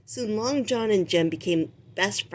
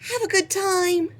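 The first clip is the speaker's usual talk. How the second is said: much higher squeaky farewell; chirpy rat; 12 semitones higher than usual. Acted. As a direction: wave him off cheerfully. Rizzo calls from the deck.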